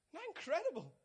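sudden high burst of wonder; a leap of awe; excited exclamation. Real, not acted. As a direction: throw it up high and amazed to the congregation. Preacher exclaims to the congregation.

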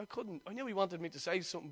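usual speaking voice

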